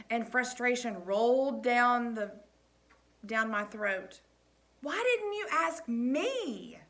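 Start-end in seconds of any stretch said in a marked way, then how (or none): 4.8-6.8 hurt question. A narrator's reading. Coach asks slowly, hurt.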